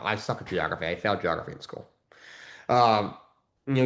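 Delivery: normal talking voice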